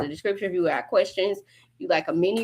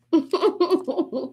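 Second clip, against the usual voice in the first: high cackle; 9 semitones higher than usual. Real, not acted. Laughs after line.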